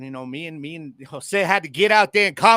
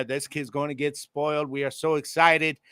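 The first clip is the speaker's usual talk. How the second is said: usual speaking voice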